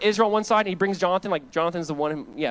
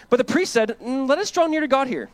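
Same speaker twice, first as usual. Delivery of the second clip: raised, ringing preaching voice; loud scripture reading; 9 semitones higher, 6 dB louder than usual. Acted. Pastor reads Saul's story aloud to church, loud and ringing. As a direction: proclaim the verses to the congregation.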